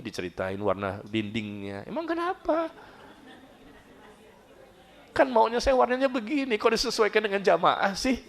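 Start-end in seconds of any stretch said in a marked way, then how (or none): 1.9-2.7 indignant retort. Retold or imitated. Defiant retort to gossip. 5.1-8.2 indignant complaint. Real, not acted. Complaining about gossip.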